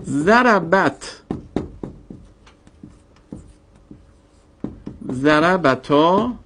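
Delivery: ضربت and ضربتا drawn out very slowly; slow demo forms. Shown as a demonstration. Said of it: "slow demo conjugation". (ضربت و ضربتا را خیلی آهسته و شمرده بگو)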